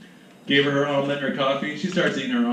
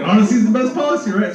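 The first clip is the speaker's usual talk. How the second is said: fast and louder, flustered; nervous rush; 5 semitones higher, 6 dB louder, faster than usual. Retold or imitated. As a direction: blurt it out quickly to stall. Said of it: "I was like".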